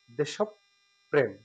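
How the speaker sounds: দেশপ্রেম said slowly and softly as "desh-op-prem"; slow, soft দেশপ্রেম with doubled p; correct pronunciation. Shown as a demonstration. Teacher closes the lesson point for students, slow and soft. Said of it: "আজ থেকে আমরা জেনে নেব এটা হবে".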